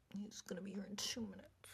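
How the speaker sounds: hushed conspiratorial whisper; sneaky whisper. Retold or imitated. Retelling Milton plotting, whispering. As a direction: whisper it like a schemer.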